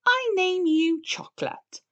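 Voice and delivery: high, loud solemn declaration; grand naming. A narrator's reading. Peppa naming her sister, high proud declaration. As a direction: proclaim it like a ceremony.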